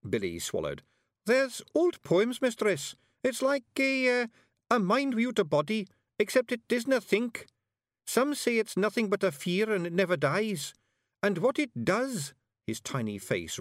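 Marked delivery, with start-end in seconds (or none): 1.3-12.3 very high, small Scottish voice; earnest Scots bard. A narrator's reading. Little bard explains hiver to witch, earnest. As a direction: explain haltingly in piping Scots.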